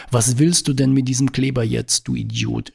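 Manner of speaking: ordinary delivery, nothing marked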